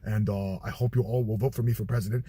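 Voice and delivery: low, deep Trump voice; Donald Trump impression. Candidate at the podium, low Trump voice. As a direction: hold it low like a blustering politician.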